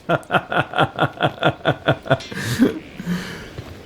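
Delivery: long staccato chuckle rising at the end; real, drawn-out run of laughter; laughing. Real, not acted. Player amused at a romance without love, laughing.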